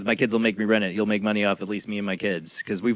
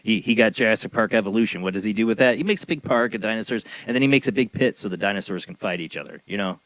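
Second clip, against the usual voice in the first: ordinary delivery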